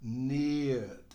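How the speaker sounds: need with a long ee sound; need pronounced the English way; clear English need. Shown as a demonstration. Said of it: "Olha a pronúncia".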